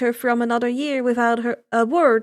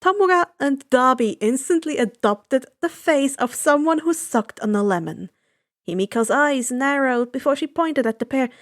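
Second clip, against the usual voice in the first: ordinary delivery, nothing marked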